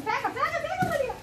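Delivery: high, coaxing sing-song; excited pet talk. Real, not acted. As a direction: egg the dog on playfully. Urging dog, high pitch.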